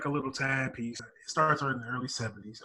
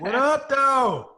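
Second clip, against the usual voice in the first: loud, high catchphrase shout; hype shout; 12 semitones higher, 9 dB louder, slower than usual. Real, not acted. Catchphrase call.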